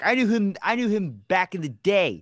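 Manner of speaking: high-pitched insistence, slowing down; mock-proud boast. Bragging about a friend who got famous, mock-proud. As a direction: play the old friend bragging.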